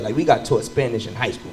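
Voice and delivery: ordinary talk, nothing marked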